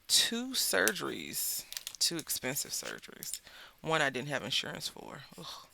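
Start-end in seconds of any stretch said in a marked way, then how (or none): none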